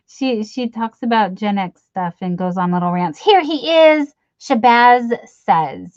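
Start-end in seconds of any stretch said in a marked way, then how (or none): 3.2-6.0 host-style introduction. Pretend. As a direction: hype up the name like an emcee.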